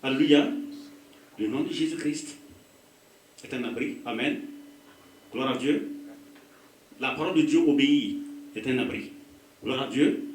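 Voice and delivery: normal talking voice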